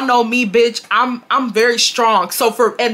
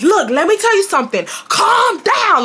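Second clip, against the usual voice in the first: imitating her high-pitched telling-off; quick lead-in, then a high punched order; bossy; 9 semitones higher than usual. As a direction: play her scolding you. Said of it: "doing like that".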